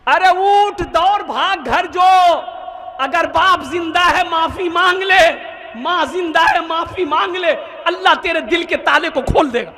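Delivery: high-pitched, impassioned preacher's shout; full-force pulpit exhortation, pitched high; urgent exhortation. Acted. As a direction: cry it out, urging him to go at once. Elder urges him to run home.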